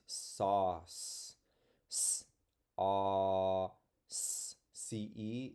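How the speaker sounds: slow, drawn-out reading of sauce; slow reading aloud. Shown as a demonstration. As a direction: stretch out the word sauce. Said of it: "this word was in the video".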